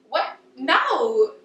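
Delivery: high-pitched, emphatic disbelief; mock-baffled, then a firm rebuttal; incredulous dismissal. Reacting to others' fear of loneliness.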